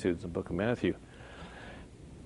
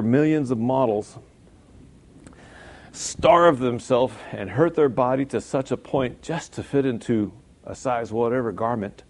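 normal talking voice

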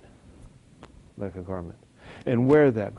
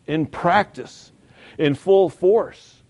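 each phrase drawn out and louder; slow emphatic build; 4 semitones higher, 6 dB louder, much slower than usual. Acted. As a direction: hammer it home word by word. Preaching build-up.

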